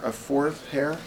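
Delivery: normal talking voice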